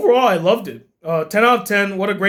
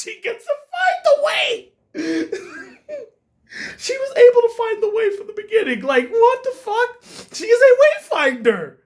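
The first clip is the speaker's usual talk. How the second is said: laugh-crying disbelief; 10 semitones higher than usual. Real, not acted.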